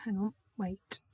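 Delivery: usual speaking voice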